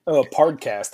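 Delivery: put-on "podcast" said as "pahd-cast" in an East Coast accent, with an r-colored "pahrd". Host mocking his own East Coast accent to his co-host. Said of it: "That's my East Coast accent coming out".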